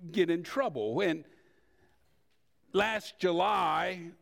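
usual speaking voice

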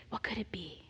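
hushed dramatic whisper; ominous whisper. Retold or imitated. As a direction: whisper it like a dark suspicion. Imagining the worst case.

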